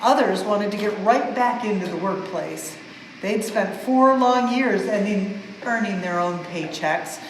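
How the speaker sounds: plain reading voice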